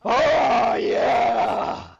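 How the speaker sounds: loud drawn-out cheer; victory yell. Real, not acted. Streamer celebrating the kill, loud victory cheer. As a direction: bellow it in triumph.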